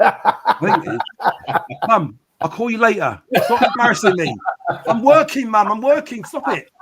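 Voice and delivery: flustered son laughing at his mum in the chat; embarrassed laughter. Real, not acted. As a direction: giggle through a mock plea to stop. Mum appears in live chat.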